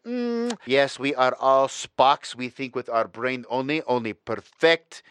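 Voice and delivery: put-on deliberately terrible German accent, slow and stiff; mock German accent, slow and over-precise; bad German accent. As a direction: play a clumsy, hammed-up German efficiency robot in a German accent. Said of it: "the worst goddamn German accent of all time".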